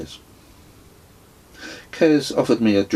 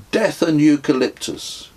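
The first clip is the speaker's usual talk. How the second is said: higher, louder toast; a mock-solemn toast among friends, higher and louder; 8 semitones higher, 6 dB louder than usual. A narrator's reading. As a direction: raise the glass and proclaim. A toast raised.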